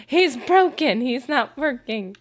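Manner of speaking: a hint of a panicked dog owner; worried owner. Owners fret that their guard dog is broken, mock alarm. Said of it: "owners thought I ruined their dog".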